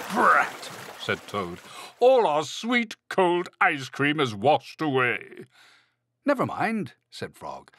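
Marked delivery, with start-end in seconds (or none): 0.1-0.7 loud, high exclamation; mock storybook frustration; vexed Toad. A narrator's reading. Toad vexed after falling in pond. 2.0-5.5 high, drawn-out storybook lament; loud wail sinking slowly to the end; lamenting Toad. A narrator's reading. Toad laments lost ice cream. 6.3-6.9 lightly voiced cheerful Frog; high, consoling storybook voice; reassuring Frog. A narrator's reading.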